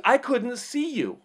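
imitating a protesting yell, high and slow; mock the driver's drawn-out protest; high-pitched retold outburst; screaming driver. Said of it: "he starts screaming at me".

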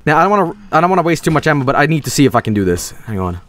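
ordinary talk

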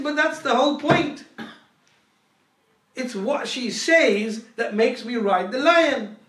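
acted forceful correction; emphatic insistence. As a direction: drive the point home loudly, drawing out key words. Saint insists on his point.

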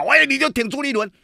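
high-pitched mock jeer at the host; mock scolding. Critics scolding host for backing Chu, mock jeer. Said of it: "很多人就骂".

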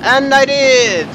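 drawn-out high, loud wail; loud dismayed admission. Real, not acted. Gamer owning up to a blunder, comically loud. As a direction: stretch it out loudly and slowly. Realises they messed up in game.

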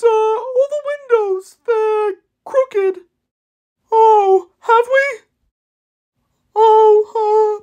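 high, hesitant, worried voice with anxious ums; a fretful helper who frets over every task; nervous timid machine. Acted. Timid crane asking Bob for help, high and nervous.

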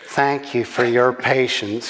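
put-on lightly groaned American accent; groaning woman. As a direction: groan it as a weary American passenger. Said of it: "she groaned".

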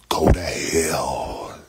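low, slow, loud go to hell; slow shout. Real, not acted. Telling a troll in chat go to hell, slow low shout. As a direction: drag out each word and bark it.

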